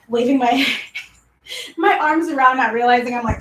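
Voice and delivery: ordinary delivery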